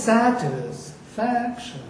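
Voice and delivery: "satisfaction" slow, down on -tis-, up on FAC; accent sticking out. Shown as a demonstration. Teacher explaining the syllable that sticks out, accented satisfaction. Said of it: "you go down in order for that syllable to stick out".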